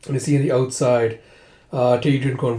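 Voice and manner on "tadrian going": usual speaking voice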